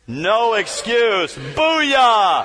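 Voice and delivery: slow "No excuse", then a loud Booyah; rally shout. Real, not acted. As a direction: fire up the crowd with a shout. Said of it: "rallying cry to audience".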